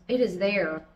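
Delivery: normal talking voice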